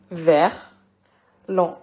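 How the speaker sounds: "vers, l'en" split into two separate syllables; syllables reversed. Shown as a demonstration. Teacher showing learners how verlan flips a word, syllable by syllable. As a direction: say "vers", pause, then "l'en". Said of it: "prononcer la dernière syllabe".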